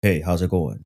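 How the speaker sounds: low, subdued deadpan impression; mock-cool, emotionless threat; no personality. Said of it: "he has no personality no more".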